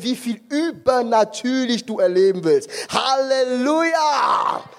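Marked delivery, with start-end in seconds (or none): 2.9-4.6 slow drawn-out Halleluja shouted; preacher's cry. Real, not acted. Preacher rallying his congregation, slow ringing shout. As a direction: stretch Halleluja into a rousing cry.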